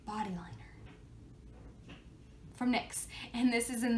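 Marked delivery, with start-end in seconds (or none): 0.1-2.0 slow whisper. Shown as a demonstration. Repeats name in whisper.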